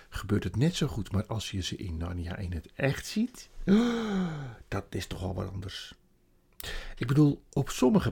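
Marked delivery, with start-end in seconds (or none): 3.5-4.6 high, sharp gasp; wondering gasp. A narrator's reading. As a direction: gasp in amazement. Narrator marvels.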